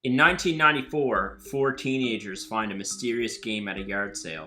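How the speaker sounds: dramatic movie trailer read. Acted. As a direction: build mystery like a trailer. Reads blurb like a trailer.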